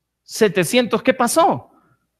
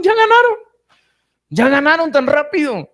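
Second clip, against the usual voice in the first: high, loud mock cheer of delighted women; excited village women; 7 semitones higher, 4 dB louder than usual. Preacher voicing the women at home greeting the soldiers, high excited cheer. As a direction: squeal it like the wives seeing the army come home.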